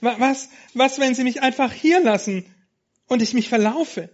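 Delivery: lost lamb fearing she'll be left, high and loud; tearful panic. Acted. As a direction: panic about being left behind. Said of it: "begann sie zu weinen".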